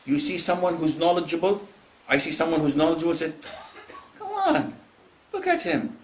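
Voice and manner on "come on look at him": high, dismissive exclamation; mock scoffing. Envier belittling a knowledgeable person, mock scoffing. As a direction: scoff in exaggerated disbelief.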